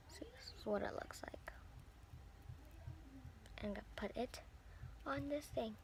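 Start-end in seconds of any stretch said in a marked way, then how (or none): 0.1-1.5 soft unvoiced whisper. Whispering to viewers while showing the strap. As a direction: show it off under your breath.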